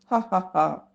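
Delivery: spelt-out mock laugh, light and teasing; a fish laughing at a crab, lightly hammed. A narrator's reading. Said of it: "Zip laughed".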